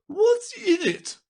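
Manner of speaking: piercingly high, loud, drawn-out demand; slow, very high royal voice; demanding queen. Acted. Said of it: "said the queen".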